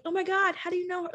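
high, gushing imitation of excited girls; bubbly, friendly. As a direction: imitate warm, chatty bathroom camaraderie. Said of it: "just bubbly energy".

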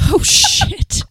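loud high surprised yelp; shocked shout. Real, not acted. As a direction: burst out in shock. Shocked reaction to militia joke.

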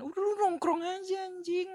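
very high, sustained comic cry; high held-out goofy holler; carefree exclamation. Acted. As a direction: shoot the pitch way up and hold it, mock-carefree. Acting out carefree post-training hangout.

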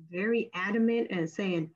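ordinary talk, nothing marked

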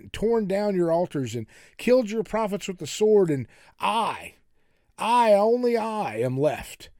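Elijah's self-pitying protest; woe is me. Acted. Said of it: "This is a real woe is me moment".

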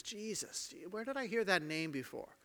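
softly puzzling over the name; hushed, pondering beggar; quiet wondering. Acted. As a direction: murmur it to yourself, searching memory.

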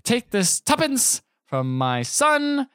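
high, slow theatrical proclamation; hammed-up giddiness. Giddy banker handing coins to his boss, grandly crazed. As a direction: draw each word out grandly.

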